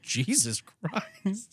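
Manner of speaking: very slow, soft, drawn-out disbelief; a shocked man breathing out his disbelief; stunned mutter. Retold or imitated. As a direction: let it out like a stunned exhale. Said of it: "he was like".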